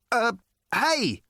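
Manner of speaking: high-pitched acted call after someone; shouted. A narrator's reading. Arthur hailing the departing alien, shouting. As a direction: yelp out to stop them leaving. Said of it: "shouted Arthur".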